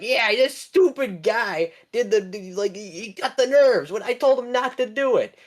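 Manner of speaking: high mock villain snitching; villain tattling. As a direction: whine it to the dad. Villain blames orange guy to dad.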